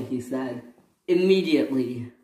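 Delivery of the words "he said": ordinary delivery, nothing marked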